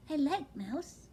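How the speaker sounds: high, small owlet voice; a young owl, wide-eyed and dazed; little owlet voice. Acted. Owlet telling his father, high and small. Plop's voice in the story.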